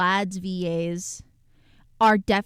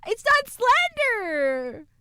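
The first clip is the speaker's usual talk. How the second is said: squeaky crying protest; 14 semitones higher, 4 dB louder, much slower than usual. Acted.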